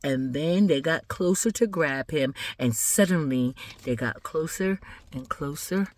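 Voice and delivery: low, soft, slowed narration; hushed suspense. A narrator's reading. Storyteller nears the motionless boy with family, hushed suspense. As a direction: draw out the suspense, low and quiet.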